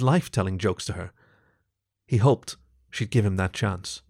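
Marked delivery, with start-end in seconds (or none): none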